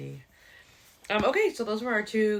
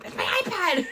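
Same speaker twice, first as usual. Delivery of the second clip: high-pitched mock kid whine; hammed-up childish outburst, way up high; four-year-old tantrum; 11 semitones higher, 4 dB louder than usual. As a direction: squeal it like a possessive child. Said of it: "like a four-year-old taking away my toy".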